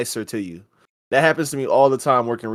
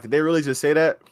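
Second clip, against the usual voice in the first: ordinary delivery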